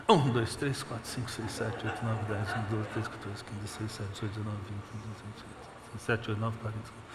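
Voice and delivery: soft, hurried counting under the breath; rapid muttered counting. Shown as a demonstration. Preacher demonstrating counting the crowd, hushed rapid count. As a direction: rattle off the numbers quietly. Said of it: "Chegamos no cem já".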